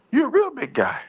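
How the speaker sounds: lightly voiced old woman marvelling at "big guy"; mock old lady.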